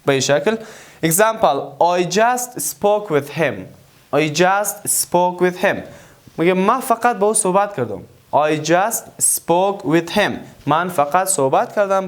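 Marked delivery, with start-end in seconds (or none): 1.1-5.8 very slow, word by word; slow dictation. Shown as a demonstration. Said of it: "teacher models slow English". 8.3-10.3 very slow, word by word; slow dictation. Shown as a demonstration. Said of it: "teacher models slow English".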